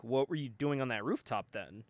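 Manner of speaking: low, soft, careful question; understated concern, voice dropped. Retold or imitated. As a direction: ask it quietly, with mild worry. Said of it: "Superman looks a little concerned here".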